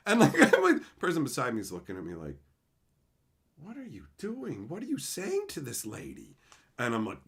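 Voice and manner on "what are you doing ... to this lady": hushed, higher, incredulous questioning; mock shocked disbelief. Coworker overhearing the phone call, hushed disbelief. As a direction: imitate an appalled coworker, soft and high.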